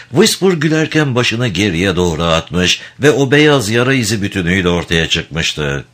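normal talking voice

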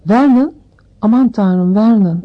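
aunt crying out to her husband in alarm; shocked gasp. A narrator's reading. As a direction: cry out in shock. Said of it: "pırıltılı bir ses çıkardı".

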